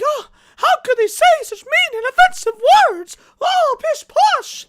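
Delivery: hammed-up scandalised British squeal; upset Brits. Mocking Brits upset by forum posts, hammed-up high-pitched outrage. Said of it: "the Brits got all upset".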